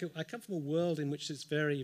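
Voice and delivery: usual speaking voice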